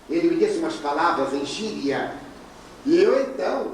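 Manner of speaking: ordinary talk, nothing marked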